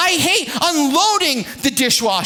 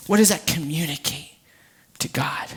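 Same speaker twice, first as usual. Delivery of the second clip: softer and softer, ending in a whisper; 9 semitones lower, 5 dB quieter than usual. Real, not acted. Preacher quietly asking the congregation. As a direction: let it fade to a whisper.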